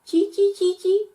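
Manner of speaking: very high squeaky chee; monkey chatter. Acted. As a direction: chatter back like a cheeky monkey.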